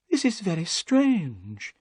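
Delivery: slow, a touch of unease; hesitant, mildly worried; understated nerves; nervous. A narrator's reading. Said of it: "said nervously".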